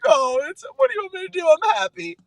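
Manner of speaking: very high-pitched mock wife voice; squeaky-high retelling of her protest; imitated emotional wife. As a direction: mimic your happy, tearful wife pleading. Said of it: "And she's like".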